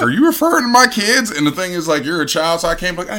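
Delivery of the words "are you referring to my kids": high, loud indignant question; shocked question. Retold or imitated. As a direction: rise up, offended and surprised. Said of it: "I was like".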